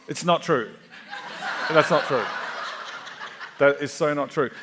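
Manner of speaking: saying "not true" through real laughter; laughing denial. Real, not acted. Dad laughing off his own joke about different mums, laughing. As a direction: laugh it off while denying it.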